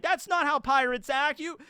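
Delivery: high-pitched mock indignation; imitated upset protest. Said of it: "he's very upset".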